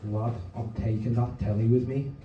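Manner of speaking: imitated Scouse drug dealer; Liverpool accent. Dealer to student in the living room, Liverpool accent. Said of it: "so that accent wasn't out of place".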